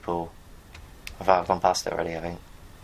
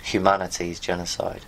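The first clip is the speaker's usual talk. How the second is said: usual speaking voice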